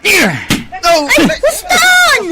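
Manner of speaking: high, loud cheering scream; excited shout. Acted. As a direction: scream with delight at the fight. Cheering the fight. (napigsa a pukkaw ti ragsak)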